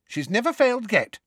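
high, confident assurance; firm. A narrator's reading. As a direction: insist firmly that all will be well. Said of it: "said Alicia Spinett firmly".

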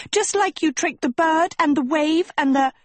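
high, indignant listing, cut off; heated accusation. A narrator's reading. Quarrel continues until cut off.

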